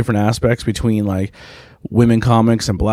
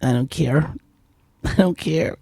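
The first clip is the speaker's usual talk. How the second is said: imitating a sulky kid; 5 semitones higher, 6 dB quieter than usual. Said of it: "and he's like".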